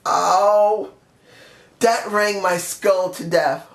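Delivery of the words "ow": short groan of real pain. Real, not acted. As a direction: moan from a self-inflicted hurt. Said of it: "I just hurt myself".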